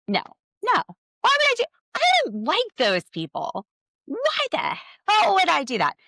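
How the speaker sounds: high, loud mocking imitation; mock Janelle voice. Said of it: "She's like".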